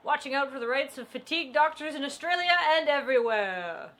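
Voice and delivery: loud, drawn-out mock hero intro; hammed-up cartoon superhero announcement; superhero announcer. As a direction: announce the hero grandly, stretching the name.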